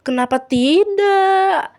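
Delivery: high, drawn-out taunt; singsong playful provocation; teasing. Acted. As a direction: tease loudly, stretching the last word. Kira taunts Laura over basket.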